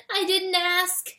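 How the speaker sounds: high, slow mouse plea; pleading. A narrator's reading. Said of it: "Freytag pleaded".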